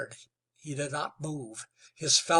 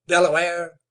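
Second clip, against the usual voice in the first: firm; 7 dB louder than usual. Acted. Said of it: "said Wetzel firmly".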